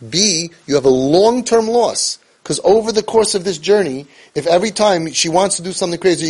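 ordinary talk, nothing marked

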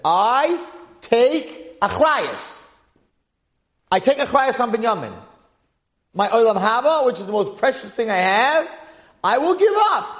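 high, loud pledge; forceful, ringing oath; impassioned vow. Acted. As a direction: declare it with full force, staking everything. Yehuda's solemn pledge to his father.

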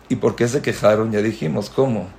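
ordinary talk

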